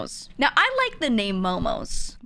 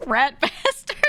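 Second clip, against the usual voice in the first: louder, laughing on the words; 5 semitones higher, 4 dB louder, slower than usual. Real, not acted. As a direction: crude name blurted through a laugh. Laughing at a crude name.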